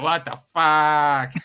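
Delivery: slow, stretched-out what the fuck; drawn-out disbelief. Pretend. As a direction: drag it out in baffled disbelief. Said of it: "just be like".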